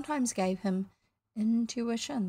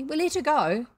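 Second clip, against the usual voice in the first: higher, louder, complacent sailor; 6 semitones higher, 5 dB louder than usual. A narrator's reading. Bosun tells captain of the prisoner, complacent. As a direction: report it as a job well done. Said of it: "Smee answered complacently".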